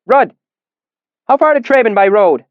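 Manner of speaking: high, loud call: "Rudd!" across the room; calling down the bar. A narrator's reading. Innkeeper calling to Rudd down the bar, loud. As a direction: shout it down the bar over the crowd. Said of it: "called down the bar".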